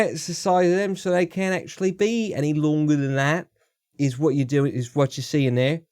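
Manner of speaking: lightly voiced British bloke, ain't and innit; British impression. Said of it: "I wasn't doing the accent well".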